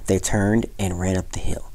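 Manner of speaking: ordinary delivery, nothing marked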